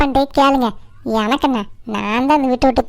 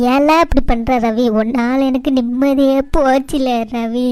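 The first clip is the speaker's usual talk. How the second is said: slow, drawn-out plaintive reproach; despairing lament; slower than usual. Acted. Mother to son who pushed away his food, despairing lament. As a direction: stretch the words out in anguish.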